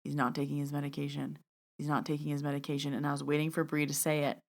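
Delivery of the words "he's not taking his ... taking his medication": low, soft repeated message; inner voice. Retold or imitated. Medium hearing a message in her head, quiet inner voice. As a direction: repeat it softly, like a voice in your head. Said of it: "I just kept hearing".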